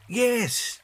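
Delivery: put-on high children's voices, excited; children jumping at the idea; eager cry. A narrator's reading. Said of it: "cried the children".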